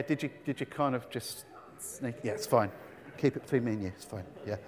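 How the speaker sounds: hushed, as if keeping a secret between two; quiet confiding aside; conspiratorial hush. Real, not acted.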